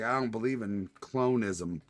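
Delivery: normal talking voice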